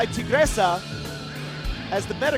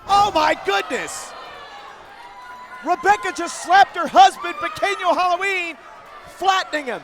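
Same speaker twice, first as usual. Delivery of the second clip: shocked announcer, higher and louder; excited shout; 6 semitones higher, 5 dB louder than usual. Real, not acted. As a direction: react big to the slap. Announcer reacts to slap.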